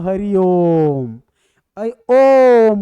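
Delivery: slow, loud, stretched ओम; drawn-out call. Shown as a demonstration. Teacher demonstrating long-vowel chanting to class, loud and slow. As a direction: call it out across a courtyard, holding ओम. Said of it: "तब तो ओम का सार्थक है".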